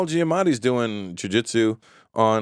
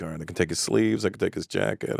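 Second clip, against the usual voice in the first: sizing him up; 6 semitones lower, 6 dB quieter than usual. Retold or imitated. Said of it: "I'm like".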